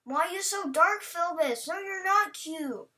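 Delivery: usual speaking voice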